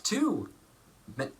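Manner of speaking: ordinary delivery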